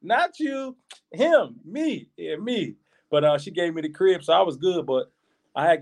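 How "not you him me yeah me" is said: higher, mock motherly voice asking; lightly voiced mother picking favourite; playing his mama, raised pitch; mama's voice.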